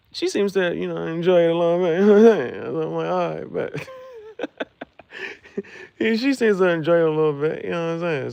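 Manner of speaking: high, loud giggly talk breaking into laughter; laughing while talking. Real, not acted. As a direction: crack up mid-sentence and keep talking. Cracks up while telling the scene.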